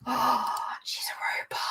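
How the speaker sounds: hushed, breath-filled wonder; gasp, then whisper it in amazement; real awed whisper; gasping surprise. Real, not acted. Gasps at the synopsis.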